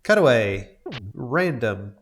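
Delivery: ordinary talk, nothing marked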